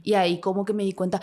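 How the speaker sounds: ordinary delivery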